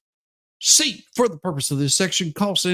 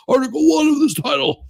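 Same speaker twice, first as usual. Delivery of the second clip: sudden shout; 9 semitones higher, 3 dB louder than usual. Pretend. Reading statute, sudden outburst.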